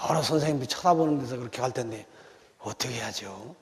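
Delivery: 낮고 작게 걱정스레 묻는 여학생; 선생님 앞에서 움츠러들어 조심스레; timid schoolgirl. Retold or imitated. Timid student pleading to pastor. (목사에게 상담하러 온 여학생, 작고 낮게 걱정스러운 하소연)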